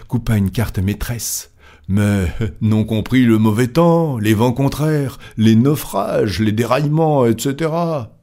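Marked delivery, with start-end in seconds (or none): 1.9-8.2 higher, insistent list of objections; heated objection. Acted. Stuart objects to Fogg's timetable, heated and insistent. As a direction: pile up the objections, pitch climbing.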